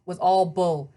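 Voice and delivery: ordinary delivery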